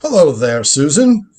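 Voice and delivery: ordinary delivery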